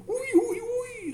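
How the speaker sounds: very high, loud frightened squeals; shrieking in fright. A narrator's reading. Aunts open the basket and find mice, shrieking in fright. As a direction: shriek it out like ladies who just saw a mouse. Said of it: "gilden de tantes".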